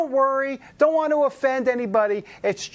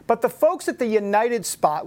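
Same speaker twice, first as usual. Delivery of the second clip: ordinary talk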